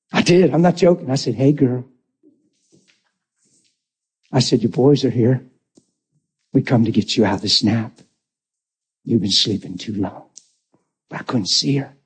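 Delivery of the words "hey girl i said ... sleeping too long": tender bedside voice. Retold or imitated. Speaking to wife in coma.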